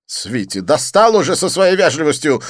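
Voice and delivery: high, loud snap at the waiter; snapping. Acted. Didier snapping at the polite waiter, high and loud. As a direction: bite his head off. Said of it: "огрызнулся Дидье".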